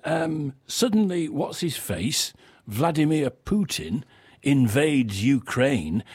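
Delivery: ordinary talk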